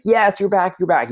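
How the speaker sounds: family greeting a traveller just back home; excited welcome. Retold or imitated. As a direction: cheer someone home after years away. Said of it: "you want everyone to be like".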